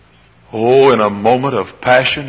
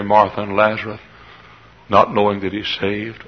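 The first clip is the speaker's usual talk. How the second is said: hushed storytelling; 4 semitones lower, 6 dB quieter than usual. Acted. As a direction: draw them in quietly.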